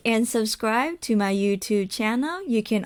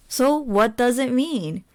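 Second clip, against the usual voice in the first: ordinary talk, nothing marked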